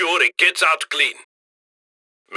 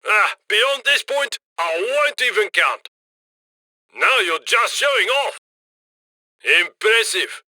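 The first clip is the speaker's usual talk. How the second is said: ordinary talk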